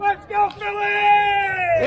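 loud, high stadium cheer. Real, not acted. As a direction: belt it out for the team. Cheering at the ballgame.

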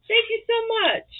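high, loud, gushing; excited thanks. Real, not acted. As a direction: burst out thankful.

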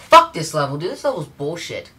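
loud, angry curse; angry outburst. Real, not acted. Gamer losing it at the level, loud angry curse. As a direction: spit it out at the game.

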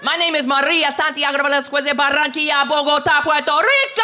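put-on high Puerto Rican-accented proclamation, rising to a long yell; mock Puerto Rican accent. Parade marcher proclaiming her long name, exaggerated Puerto Rican accent.

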